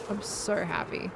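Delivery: flat, slow deadpan happy; monotone voice. Real, not acted. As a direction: say it totally flat. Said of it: "in my very lovely monotone voice".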